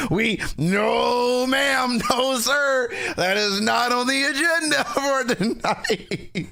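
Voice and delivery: very high, laughing through a playful no; giggling while playing the strict host; laughing mock refusal. Streamer laughing as he refuses the chat, very high.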